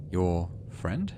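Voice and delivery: ordinary talk, nothing marked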